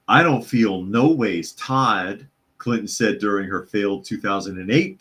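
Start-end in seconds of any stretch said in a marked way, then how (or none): 0.1-2.3 slow, stretched Southern drawl impression of a politician; mock Southern drawl. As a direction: drag it out in a put-on Southern accent. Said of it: "Yeah, she does that".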